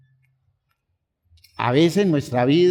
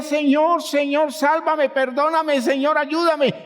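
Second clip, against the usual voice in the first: much higher frantic crying out; panicked cry; 13 semitones higher than usual. Retold or imitated. Preacher acting out people fleeing an earthquake, frantic high cry. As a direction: scream for help as the earth quakes. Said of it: "La gente sale corriendo y grita".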